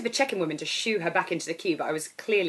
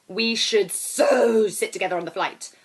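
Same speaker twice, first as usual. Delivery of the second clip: put-on 1 brash girl; 3 semitones higher, 7 dB louder than usual.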